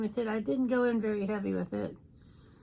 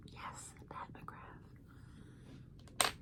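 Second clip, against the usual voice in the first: whisper; 16 dB quieter, much slower than usual. Acted. Whispered teaser about giveaway.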